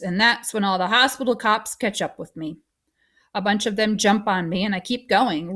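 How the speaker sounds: plain reading voice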